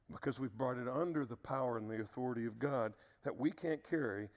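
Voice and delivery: ordinary talk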